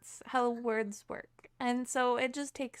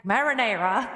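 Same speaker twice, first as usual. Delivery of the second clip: louder, lower mock American accent; brash American; 3 semitones lower, 6 dB louder than usual. Streamer contrasting America with posh British, loud American accent. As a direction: blurt it in exaggerated American. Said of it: "And then America is like".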